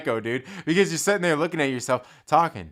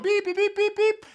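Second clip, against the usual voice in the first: high, even run of mimicked beeps; imitated beeps. Caller cut off mid-threat in the retold call, imitated beeping. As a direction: beep like a disconnected line, high-pitched.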